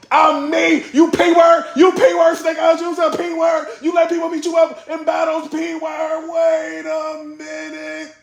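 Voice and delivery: very high mock shout, last words stretched out long; over-the-top crazy rant. Imitating a rapper berating his opponent, over-the-top high shouting. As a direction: yell like a battle rapper gone wild. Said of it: "He talking crazy".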